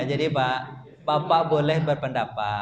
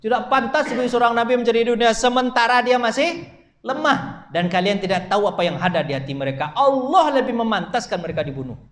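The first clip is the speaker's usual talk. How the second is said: raised, forceful voice of reprimand; stern rebuke; 6 semitones higher, 5 dB louder than usual. Acted. Preacher voices the verse scolding the Prophet, stern rebuke. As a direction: declaim it hard, punching the key words. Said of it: "bahasa ditegur begitu".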